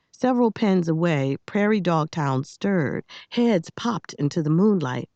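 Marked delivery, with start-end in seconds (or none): none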